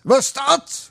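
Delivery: high, stern dialect question. Acted. Uncle sternly questioning the boy, in dialect. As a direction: demand it sternly. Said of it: "vroeg oom streng".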